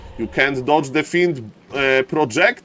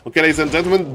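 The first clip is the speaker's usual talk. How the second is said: ordinary talk, nothing marked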